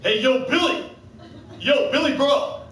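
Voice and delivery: loud, high, hammed-up American holler; American accent. Shown as a demonstration. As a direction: yell it out like an over-the-top American. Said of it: "this is my best American accent".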